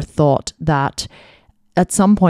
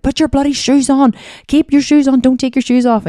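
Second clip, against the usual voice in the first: high-pitched exasperated yell, much higher and louder; yelling at the kids; 10 semitones higher, 4 dB louder than usual. Retold or imitated. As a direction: shout at the toddlers to keep their shoes on. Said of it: "and then you're like".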